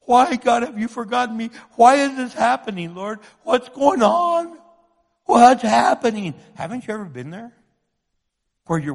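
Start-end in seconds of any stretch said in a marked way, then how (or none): 0.0-1.5 higher, emphatic plea to God; crying out. Acted. David asking God why he is forgotten, crying out. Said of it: "David's crying out". 1.7-6.3 crying out. Acted. David bewildered before the Lord, crying out. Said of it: "David's crying out".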